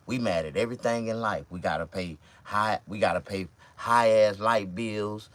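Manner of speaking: ordinary talk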